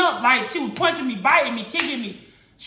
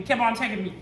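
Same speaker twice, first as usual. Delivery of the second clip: normal talking voice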